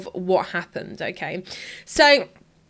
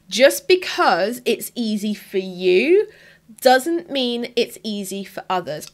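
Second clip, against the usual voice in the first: slow, measured repetition for emphasis; emphatic repeat; slower than usual. Real, not acted. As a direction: drive the point home word by word, slowly. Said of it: "I'm gonna say that again".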